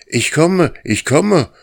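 loud, high eager answer; hurrying call in reply; calling back. Acted. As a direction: shout back while running. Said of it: "antwortete der Hund".

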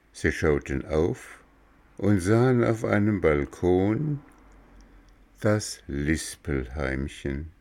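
ordinary delivery, nothing marked

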